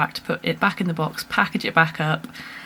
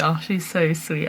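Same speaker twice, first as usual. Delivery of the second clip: ordinary talk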